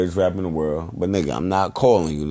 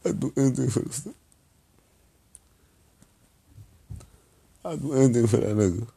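choked up; 3 dB quieter than usual. Real, not acted. Breaking down over his cousin.

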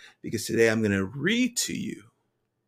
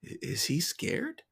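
soft, higher wondering; hushed, tentative question; thinking aloud; 6 semitones higher, 11 dB quieter than usual. A narrator's reading. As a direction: wonder it softly to yourself. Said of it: "I think".

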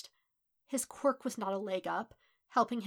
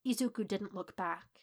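usual speaking voice

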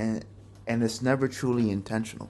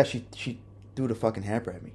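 usual speaking voice

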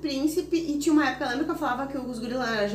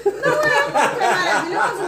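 laughing; 11 semitones higher, 5 dB louder, slower than usual. Real, not acted. Laughing through praise.

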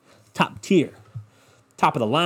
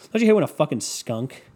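ordinary talk

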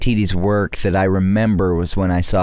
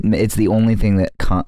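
ordinary talk

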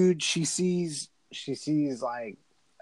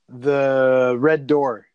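very slow, drawn out and louder; dramatic slow reveal; 8 dB louder, much slower than usual. Retold or imitated. Building suspense for her cohost with a slow dramatic reveal. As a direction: savour each word like a horror trailer.

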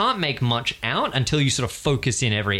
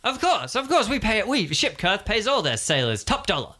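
eager recruiter; 8 semitones higher than usual. Acted. Recruiter reassures about pay.

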